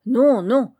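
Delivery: loud, high quick denial; loud protest. A narrator's reading. Mason cutting in to defend Titik, loud protest. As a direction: cut in, protesting.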